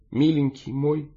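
high, slow, tender endearment; tearful murmur. A narrator's reading. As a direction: murmur through swallowed tears. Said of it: "бормотала она, глотая слёзы". (сквозь слёзы, нежно)

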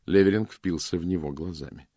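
usual speaking voice

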